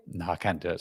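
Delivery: normal talking voice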